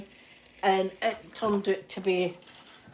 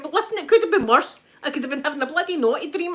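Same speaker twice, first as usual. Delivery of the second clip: higher, louder, quick cheeky comeback; cheeky retort; 5 semitones higher, 5 dB louder, faster than usual. Retold or imitated. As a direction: fire it back with mischief. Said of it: "And I went".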